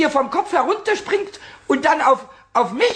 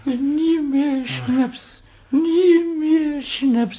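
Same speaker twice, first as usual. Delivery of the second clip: very slow, drawn-out vow; slow, drawn-out hungover vow; 3 semitones higher, much slower than usual. Acted. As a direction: stretch every word like a hangover pledge. Pumuckl vows, hungover.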